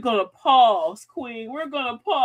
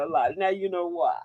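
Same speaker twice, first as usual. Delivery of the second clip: usual speaking voice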